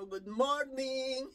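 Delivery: slow, drawn-out sing-out greeting; re-enacted cheery greeting. Caregiver arriving at the employers' door, drawn-out greeting. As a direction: stretch the greeting out as you walk in. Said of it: "sabi ko".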